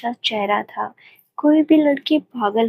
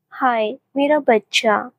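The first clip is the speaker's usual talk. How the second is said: ordinary delivery, nothing marked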